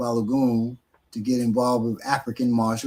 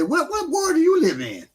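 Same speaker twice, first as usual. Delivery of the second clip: imitating; 14 semitones higher than usual. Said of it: "I said".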